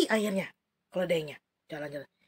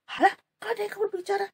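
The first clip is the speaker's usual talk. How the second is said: quick, higher startled question; surprised exclaim; 8 semitones higher, 4 dB quieter, faster than usual. Acted. Bileam startled that his donkey talks, quick and higher. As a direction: react in disbelief. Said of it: "Bileam kaget".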